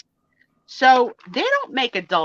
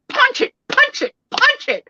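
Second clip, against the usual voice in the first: "Punch it" repeated much higher and louder; insistent begging; 4 dB louder than usual. Retold or imitated. Wife begging husband to punch it, loud pleading. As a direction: plead hammering each "punch it". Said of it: "I was like".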